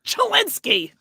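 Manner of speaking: put-on high Babs squawk. Co-host trying out the voice, high Babs impression. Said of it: "it kind of is the Babs voice".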